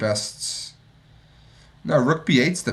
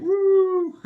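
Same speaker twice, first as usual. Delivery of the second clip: very high imitated "woo" scream; meme scream; 4 dB louder than usual. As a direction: mimic a screaming guy in falsetto. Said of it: "the guy screaming".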